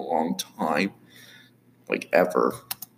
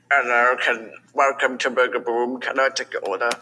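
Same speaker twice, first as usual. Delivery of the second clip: slightly raised cartoon impression voice; Doofenshmirtz; 4 semitones higher than usual. Drive-thru worker greeting a customer, bad cartoon impression. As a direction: put on a rough cartoon impression. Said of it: "that voice kinda sounds like my Doofenshmirtz impression".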